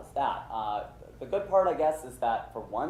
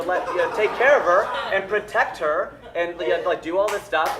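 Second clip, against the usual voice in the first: mock heroic; 8 semitones higher, 10 dB louder than usual. Mocking manly protector role.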